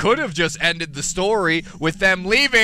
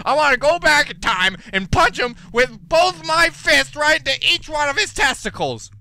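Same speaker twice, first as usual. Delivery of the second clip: angry rant; 5 semitones higher than usual. Real, not acted. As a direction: vent at the book, full force. Ranting about hated school book.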